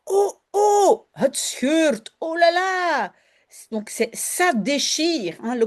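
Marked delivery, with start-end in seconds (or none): 0.1-3.1 dismay. Acted. Pillow tearing in story.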